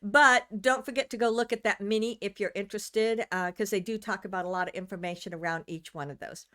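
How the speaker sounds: ordinary delivery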